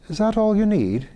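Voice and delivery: high, gently pitying Irish lord. Acted. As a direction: ask kindly, in an Irish lilt. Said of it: "he asked in a softer voice".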